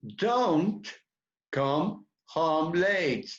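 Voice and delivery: Don't come home late, said very slowly; slow classroom demo; slow model pronunciation. Shown as a demonstration. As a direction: spell out each word slowly for learners. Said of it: "cómo se pronuncia".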